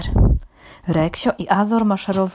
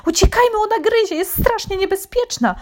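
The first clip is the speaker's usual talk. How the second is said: very high-pitched alarm; frightened animals crying out; panicked cry; 14 semitones higher, 4 dB louder than usual. A narrator's reading. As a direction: call everyone to flee. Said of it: "zawołały zwierzęta".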